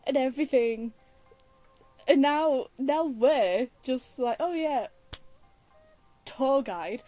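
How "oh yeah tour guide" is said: normal talking voice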